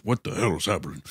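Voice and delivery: low, mumbled mock Oppenheimer. Mocking Oppenheimer's reaction to the bomb news, low and soft. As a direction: drop low and soft, lightly shocked. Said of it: "And then Oppenheimer's like".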